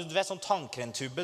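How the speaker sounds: ordinary talk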